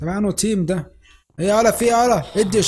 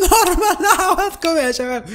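laughing plea; 9 semitones higher, 3 dB louder than usual. Real, not acted. Laughing plea for normal game.

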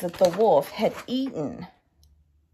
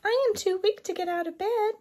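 put-on high weak old woman voice; frail granny voice; 12 semitones higher, faster than usual. A narrator's reading. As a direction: sound too weak to get up. Grandmother speaks from bed.

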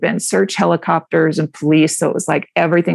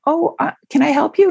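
much higher, sweetly polite voice; polite sweet lady; 9 semitones higher than usual. Retold or imitated. Woman politely greeting a stranger at her door, sweet and high. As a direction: chirp it like a too-nice hostess at the door. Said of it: "a female thing to be like".

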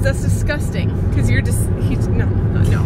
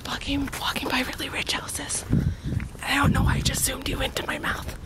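hushed whisper past the houses; 9 dB quieter than usual. Real, not acted. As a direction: whisper so nobody hears.

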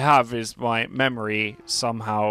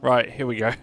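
ordinary talk

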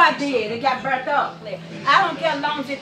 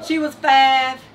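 louder, emphatic restatement; insistent repeat; 6 dB louder, much slower than usual. Real, not acted. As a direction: say it firmly to get it across. Repeats it over the talk.

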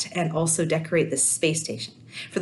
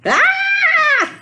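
very high, loud shriek of alarm; high startled mother's cry; alarmed scream; 10 dB louder than usual. A narrator's reading. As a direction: scream in sudden panic. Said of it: "yelled Sierra's mom".

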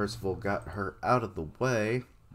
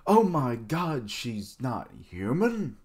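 loud, high, slow incredulous exclamation; hammed-up disbelief, drawn out; mock shock; 5 semitones higher, 5 dB louder, slower than usual. Reader acts out the reaction expected at a transformation, mock shock. Acting the reaction they should have.

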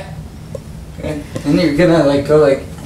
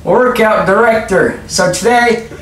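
high, drawn-out instructor voice; Gerald, workout director; 6 semitones higher, slower than usual. Pretend. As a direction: introduce the workout as a hammed-up trainer.

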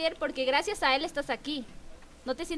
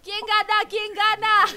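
high, loud, long-drawn calls; excited announcer yell; race-finish shouting; 6 semitones higher, 8 dB louder, much slower than usual. Real, not acted. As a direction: holler the climax of the race. Host calling the race finish.

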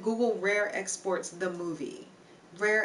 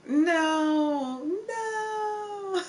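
mock horrified no; 10 semitones higher, 5 dB louder than usual. Chat names racy search, mock horror.

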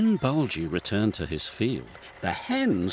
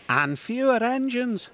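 Emily; 12 semitones higher than usual. A narrator's reading. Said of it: "said Emily".

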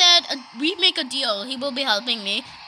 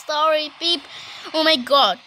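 ordinary talk

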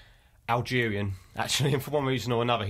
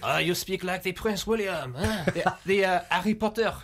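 high, slow imitation of a French speaker; mock French-accented English, pitched up; 7 semitones higher than usual. Imitating French people judging his accent, in a French accent. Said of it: "What did the French think of your accent?".

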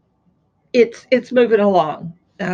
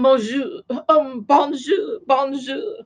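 put-on French accent; 3 semitones higher, slower than usual. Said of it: "it'd be like if I was going to France".

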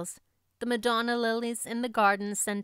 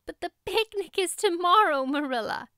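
high, louder desperate plea; crying out; 9 semitones higher, 5 dB louder than usual. A narrator's reading. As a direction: cry out as a distraught girl. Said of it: "cried Anne".

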